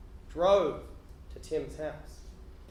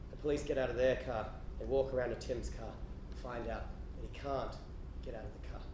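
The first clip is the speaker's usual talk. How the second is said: usual speaking voice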